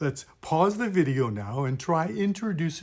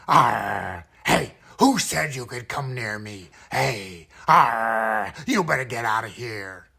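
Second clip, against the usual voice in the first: lower, louder, throaty pirate threat; pirate bad guy; 3 semitones lower, 5 dB louder than usual. Bad guy warning someone away from him, gruff pirate voice. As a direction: scare the kids off like a surly pirate. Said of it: "the voice of a bad guy".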